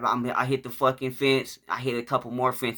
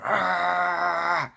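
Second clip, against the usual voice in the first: long high strained "arrgh"; imitated yell of being grabbed by a dog; drawn-out mock cry of pain; 6 semitones higher than usual. Imitates pain as dog snatches him.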